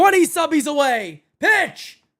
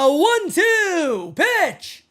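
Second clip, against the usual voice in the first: slow, suspenseful play-by-play call; drawn-out pitch call; much slower than usual. Real, not acted. Streamer calls the pitch for viewers, drawn out. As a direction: stretch the words out slowly.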